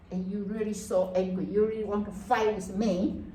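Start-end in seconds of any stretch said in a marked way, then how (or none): none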